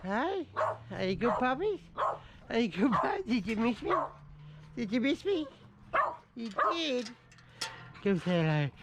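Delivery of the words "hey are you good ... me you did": high, sing-song voice for puppies. Real, not acted. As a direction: coo at the dogs in a high voice. Greeting the dogs at home.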